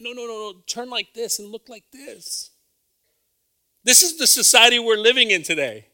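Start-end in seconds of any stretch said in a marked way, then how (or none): 0.0-2.5 imitating the mom. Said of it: "and the mom's like".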